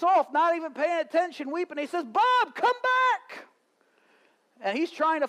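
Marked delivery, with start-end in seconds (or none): 2.0-3.5 high, loud plea to come back; calling out. Retold or imitated. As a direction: yell after him to return. Cousin calling friend back.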